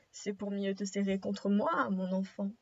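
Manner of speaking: low-pitched pretend grandmother; wolf's grandmother voice. Wolf answers girl from the bed, low grandmother voice.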